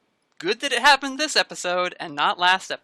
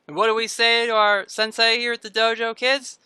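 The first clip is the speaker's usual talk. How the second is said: high, loud singsong question to a class; raised teacherly call for an answer; sensei prompting kids; 7 semitones higher, 6 dB louder than usual. Acted. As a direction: lead the kids into their reply.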